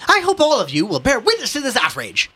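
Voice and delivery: high, indignant proclamation; haughty. Acted. Ignatius calling the crowd to witness, haughty and outraged. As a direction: address the crowd with pompous outrage. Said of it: "Ignatius said haughtily".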